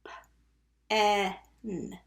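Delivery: "pen" in separate sounds with a held short e and n; sounded out. Shown as a demonstration. Teacher asking pupils to listen carefully, sounded out. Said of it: "Listen carefully".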